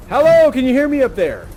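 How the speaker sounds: teacher calling up to the balcony. Real, not acted. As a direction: project up to the students above.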